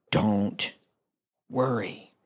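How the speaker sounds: low voice, each word set apart; deliberate, low-pitched command; slow, grave assurance. Acted. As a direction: say it low with a long pause between the words. Gives Jesus' words slowly and low.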